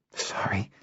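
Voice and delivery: whispered gasp; out of breath. A narrator's reading. Kindon apologizes after running back, out of breath. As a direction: gasp it softly, winded from running. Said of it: "Kindon gasped".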